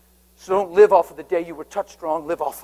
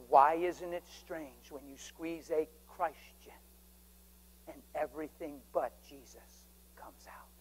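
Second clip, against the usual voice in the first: hushed; 3 semitones lower, 16 dB quieter, slower than usual. Retold or imitated. Lord's words, fading to whisper.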